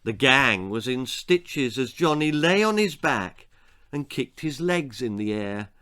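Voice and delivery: usual speaking voice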